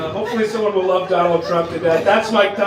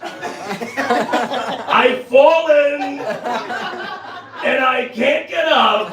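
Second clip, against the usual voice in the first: slow, higher mock Trump-grandma through laughter; 5 semitones higher, much slower than usual. Grandma who does Trump, slow mock plea. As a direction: drag it out like a helpless old lady. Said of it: "she's like".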